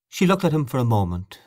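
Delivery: usual speaking voice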